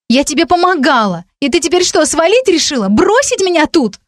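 very high-pitched indignant accusation; hurt outrage. A narrator's reading. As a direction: accuse in wounded disbelief, voice climbing. Stunned friend feels abandoned. (обиженное возмущение)

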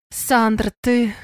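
ordinary delivery

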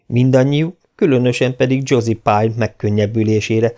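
ordinary delivery

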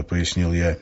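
ordinary delivery

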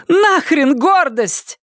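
high, drawn-out defiant cry; Полли. A narrator's reading. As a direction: throw it out as a high-pitched cry. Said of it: "крикнула Полли". (отчаянный крик высоким голосом)

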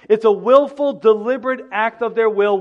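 preacher raising his voice to stress the point; emphatic. Real, not acted. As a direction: press every word with force. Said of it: "It's emphatic".

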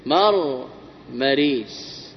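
مرمريس very slowly, broken after مر; split into syllables. Shown as a demonstration. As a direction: say مرمريس in two slow, separate pieces. Teacher splits word for analysis.

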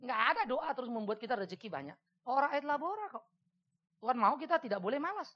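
normal talking voice

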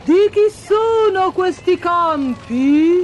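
high, slow, drawn-out royal question; inquiring king. A narrator's reading. King leans out to ask the reapers, slow high questioning call. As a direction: call it out as a storybook king, rising at the end. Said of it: "il re, sporgendosi, chiese".

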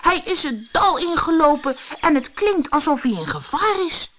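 high, worried exclamation; acted anxious storybook hero; urgent alarm. Alarmed hero fears friend in danger.

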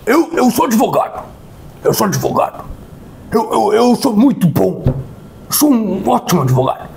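a hint of a smug, pompous lawyer; mocking the lawyer. Narrator mocks the lawyer relative bragging, lightly voiced. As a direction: puff it up like a self-important lawyer.

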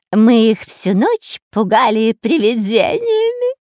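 high, slow, loud tittering taunt; giggling villain. A narrator's reading. Spy confesses the ghost trick, giggling gleefully. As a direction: stretch it out with a sniggering glee. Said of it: "хихикал Дырка".

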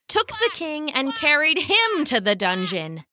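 plain reading voice

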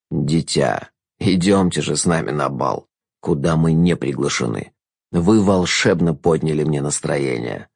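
low, slow, smooth jester voice; silky courtly trickster; sly jester. A narrator's reading. Jester's lines.

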